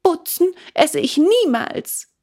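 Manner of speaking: put-on high, outraged little wooden boy; indignant puppet. A narrator's reading. Puppet protesting to his father, high and indignant. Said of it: "tat dabei sehr entrüstet".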